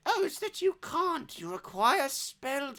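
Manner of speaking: high granny voice, slowing and dropping; teasing granny. Acted. Granny chides the boy about firing guns, teasing.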